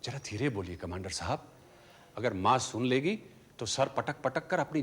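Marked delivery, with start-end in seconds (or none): 0.0-1.5 low, soft shushing; lowered, secretive voice; hushed warning. Acted. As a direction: hush the visitor so mother won't overhear. Hushing so mother won't hear.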